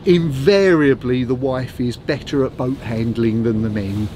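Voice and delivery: ordinary talk, nothing marked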